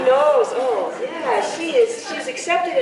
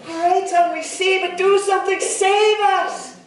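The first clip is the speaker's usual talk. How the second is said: desperate plea; 7 semitones higher than usual. A narrator's reading. Body parts beg the tongue.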